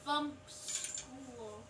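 normal talking voice

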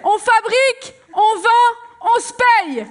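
high, loud, punchy slogan cries; rallying slogan shout. Acted. Worker-priest proposes self-management to the assembly, rallying shout. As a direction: fire up the crowd phrase by phrase. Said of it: "je suis Jean Ragnès".

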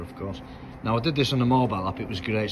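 normal talking voice

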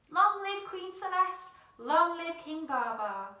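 slow, loud ringing cheer; shouted cheer. A narrator's reading. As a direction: proclaim it to the whole herd. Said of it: "the elephants shouted with one voice".

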